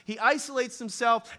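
usual speaking voice

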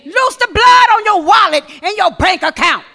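loud, high, fervent proclamation; preaching at full force. Acted. Preacher declaring blessing over the church, loud and high. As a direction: cry it out over the congregation.

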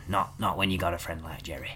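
very soft whispered exchange; whispering thanks to a friend. Acted. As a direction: hush it right down. Mutual whispered sentiment.

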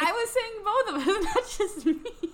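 high laughing protest. Real, not acted.

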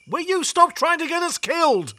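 high-pitched, furious outburst; exasperated yell. Acted. Passenger yelling at the reckless cab driver, exasperated.

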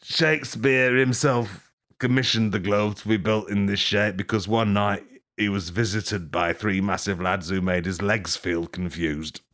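ordinary talk, nothing marked

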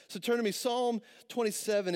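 ordinary talk

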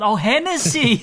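the penny drops, say it bright and loud; sudden realisation. Retold or imitated. Bartender's realisation retold.

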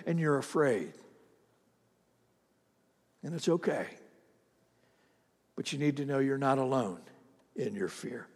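soft pastoral comfort after long pauses; hushed, unhurried reassurance; gentle reassurance. Real, not acted. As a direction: drop to a quiet, consoling voice. Pastor reassures the fearful in church.

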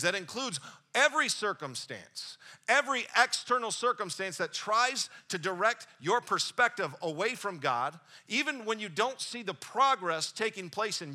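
full-force sermon delivery; preaching. Acted. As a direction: drive it home to the congregation.

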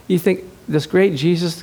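ordinary talk, nothing marked

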